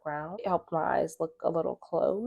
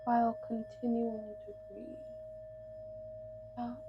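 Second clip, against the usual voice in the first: guided meditation; 4 semitones higher than usual. Acted. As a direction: guide them gently through breath and stretch, unhurried.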